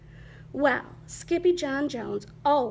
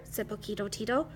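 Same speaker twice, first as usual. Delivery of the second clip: usual speaking voice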